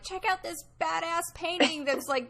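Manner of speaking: high-pitched show-and-tell brag; playful high brag. Acted. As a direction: boast about your prize, stretching the end. Showing off imaginary painting to others.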